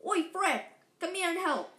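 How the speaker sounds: calling out. Acted. Twin calling his brother over to help with the trunk, loud.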